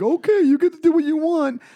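high, loud sing-along pushover voice; exaggerated, high-pitched agreeableness; mock appeasement. Mocking giving in to hecklers.

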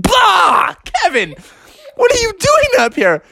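very high-pitched shocked cry; hammed-up startled frog; startled yelp. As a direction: jump out of your skin, squawking. Froggy startled by Kevin.

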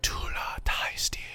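whispered ASMR elf voice; elven ASMR whisper. Pretend. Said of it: "Elven A S M R".

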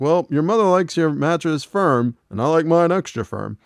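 snippy wife talking back; Peggy's retort. Retold or imitated. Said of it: "And she's like".

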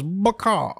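high squeaky one-word taunt; chicken devil voice. Acted. Chicken devil taunting the student as it dies. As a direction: squawk it like a cartoon chicken. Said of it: "Bucky goes".

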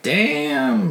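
high, breathy-free swooning "Damn"; impressed swoon. Retold or imitated. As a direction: swoon over him in a high voice. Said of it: "she's like".

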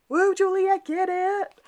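high, drawn-out teasing whoop; cheering on. Retold or imitated. Viewer cheering Juliet on as she flirts, high teasing whoop.

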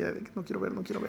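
play-acted timid murmur; mock dread. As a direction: fret under the voice, pretending dread. Mimics dreading opening test results.

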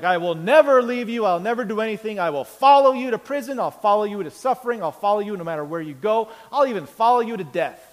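high, loud boastful vow; superhuman voice. Retold or imitated. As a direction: swear undying loyalty like a hero. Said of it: "he stands up with this superhuman voice".

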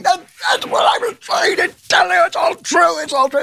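very high, strained shouting; hammed-up shrieky "I told you so"; exasperated listener's outcry. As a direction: cry it out at a frantic high pitch. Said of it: "I know what you're saying. You're saying".

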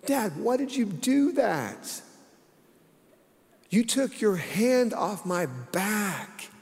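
put-on high, slow whiny child's wail; imitating wailing daughter. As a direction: play the hurt little girl, drawn out. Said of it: "She says".

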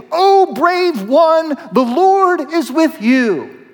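slow, lofty angelic greeting; solemn angel voice. Acted. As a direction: proclaim it slowly like a heavenly messenger. Angel greets hiding Gideon.

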